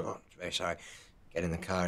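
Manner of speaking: quiet, submissive mock apology; meek reply. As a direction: answer meekly like a scolded kid. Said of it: "And Viggo go".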